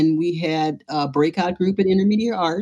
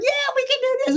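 much higher, chirpy can-do voice; faster than usual. Retold or imitated. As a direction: mimic an over-eager optimist.

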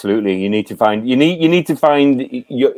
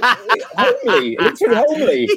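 confused repeat; 10 semitones higher than usual. Retold or imitated. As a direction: repeat the word high and baffled, laughing. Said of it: "I'm like".

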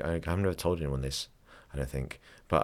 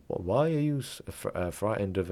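lightly put-on Count-like foreign accent; hypnotherapist's accent; 3 semitones higher than usual. Imitating a hypnotherapist questioning the patient, in a put-on accent. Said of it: "I'm doing his accent".

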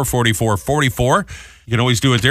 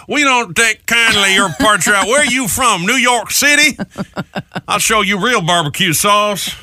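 higher, slowish hammed-up Texan drawl; a hostile backwoods local sizing up a New Yorker, hammed-up Texan accent; 6 semitones higher, slower than usual. Host imagines a Texas woodsman confronting campers, mock Texan accent. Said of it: "you get some guy going".

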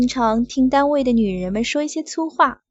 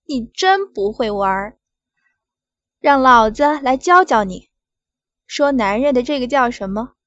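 loud scolding; 6 dB louder than usual. Acted. Boss demanding, scolding.

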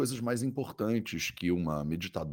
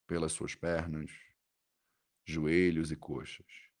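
soothing guided meditation. Acted. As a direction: guide the body scan gently, with long pauses.

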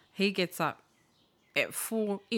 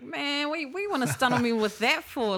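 higher, louder mock protest; exasperated retort; 7 semitones higher, 4 dB louder than usual. Son retorts to dad over the real job, exasperated. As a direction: throw it back in disbelief.

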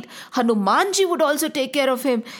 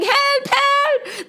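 panicked cry for help; 14 semitones higher than usual. Acted. Townspeople crying to the king, very high panicked shriek. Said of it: "Everybody was shouting".

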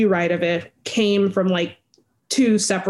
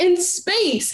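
lightly voiced naive kid, pitched higher; 10 semitones higher than usual. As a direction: answer like a clueless little boy, a touch higher. Kid answers in a comic.

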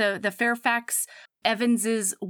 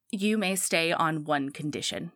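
low, softer, grudging surly voice; adorably surly; 7 semitones lower, 4 dB quieter than usual. Retold or imitated. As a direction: retell it as an endearingly grumpy concession. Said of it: "in a very adorably surly voice".

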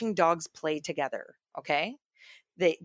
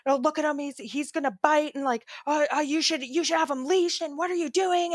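high-pitched mock panic, words tumbling out; losing it; 11 semitones higher than usual. Freaked-out woman telling dog owner to leash him, losing it. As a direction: imitate a frantic woman scolding a dog owner. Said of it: "lost it on him".

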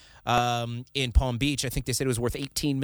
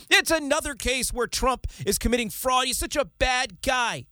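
much higher, whiny mock outrage; mocking liberal viewer; 10 semitones higher than usual. Host mocking liberal viewers at home, much higher whiny voice. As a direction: mimic a smug viewer who has heard it all. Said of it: "this is definitely what the liberals are saying".

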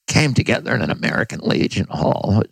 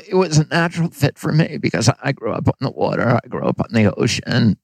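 normal talking voice

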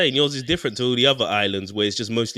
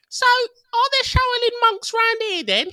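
much higher, slow mock European tourist accent; European holiday accent; slower than usual. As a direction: ham up a clueless tourist in China. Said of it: "my European on holiday accent when I go to China".

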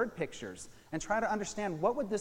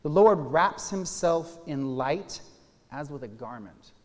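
proclaiming scripture; 10 dB louder than usual. Acted. Pastor reads Psalm 104 aloud to his listeners, proclaiming it loudly. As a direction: declaim it like a psalm of worship.

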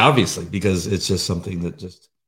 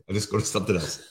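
ordinary talk, nothing marked

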